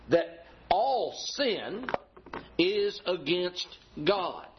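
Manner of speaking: all sin is against God, each word slowed right down; slow and deliberate. Acted. Preacher to congregation, slowing down to press the point. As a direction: lay it out slowly, word by word.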